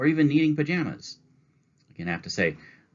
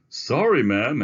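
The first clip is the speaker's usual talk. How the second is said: higher, louder apologetic salesman; 7 semitones higher, 8 dB louder, slower than usual. Acted. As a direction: apologize briskly to a customer. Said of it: "answered the salesman".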